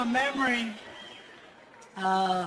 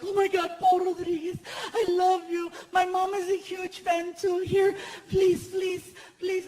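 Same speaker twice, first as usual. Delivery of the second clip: much higher squealing fan, near tears; gushing fan; 8 semitones higher than usual. Retold or imitated. Girl in the car begging Paul, high squealing plea. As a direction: beg and squeal like a starstruck fan. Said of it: "she's like".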